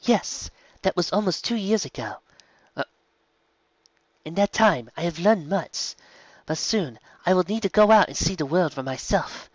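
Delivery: put-on eager young zebra. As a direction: pepper the guest with excited questions.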